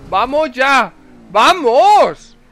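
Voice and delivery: high, very loud cheer; triumphant shout. Real, not acted. Gamer landing a huge jump, triumphant cheer. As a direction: roar after the big jump.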